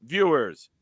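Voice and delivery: loud, high proclamation; town crier. Pretend. Host announces fans like a crier, booming. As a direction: call it out like a town crier to the crowd.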